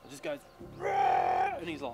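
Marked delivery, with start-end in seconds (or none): 0.8-1.6 high loud mock rah; imitated fish roar. Telling mate about the fish strike, loud high rah. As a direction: yelp out like the fish exploding on the lure.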